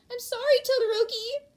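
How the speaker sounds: high, loud apologetic cry; sheepish girl apology. Acted. Mina apologising to Todoroki, high sheepish cry. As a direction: apologise cutely, a bit guilty.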